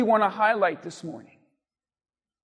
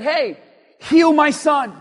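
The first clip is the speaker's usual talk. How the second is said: mock order to Jesus, higher and louder; bossy demand; 5 semitones higher, 7 dB louder, slower than usual. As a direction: act out a man giving orders. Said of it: "and told him what to do".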